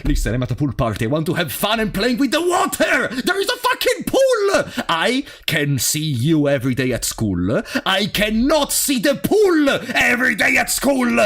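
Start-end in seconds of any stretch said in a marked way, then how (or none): 1.0-11.2 excited shout. Real, not acted. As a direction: shout it like the pool matters most. Streamer yells about the pool.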